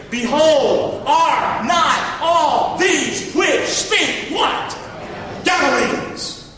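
slow, high, loud, each word stretched; emphatic pulpit reading; drawn-out scripture reading. Real, not acted. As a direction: pull the congregation into the verse. Preacher reads verse with congregation.

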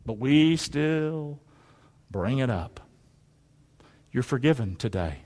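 low, soft and slow; hushed and sober. Real, not acted. Preacher gently reassuring congregation of forgiveness, hushed. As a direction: drop right down and let it settle. Sober turn after rousing call-response.

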